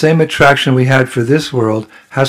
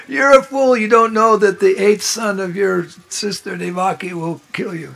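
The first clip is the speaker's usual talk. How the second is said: lightly raised heavenly scolding that fades; voice from the sky; 5 semitones higher, 6 dB quieter than usual. Acted. Sky voice warning Kamsa, high then fading. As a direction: start high and let it sink away. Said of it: "He heard the voice from the sky".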